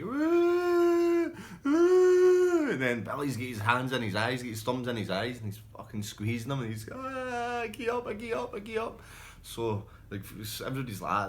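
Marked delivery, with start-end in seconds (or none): 0.0-2.7 shrill high pig-like squeal, very loud. Retold or imitated. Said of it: "Crawley's squealing, man, like a pig". 6.9-9.0 pained yelling. Retold or imitated. Eyes squeezed in fight.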